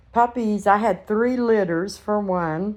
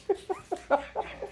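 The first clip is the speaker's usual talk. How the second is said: breathy giggle; 10 semitones higher, 9 dB quieter than usual. Real, not acted. Laughing at puppies.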